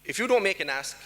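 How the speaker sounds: usual speaking voice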